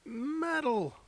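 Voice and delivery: very high squeal of joy; excited cheer. Real, not acted.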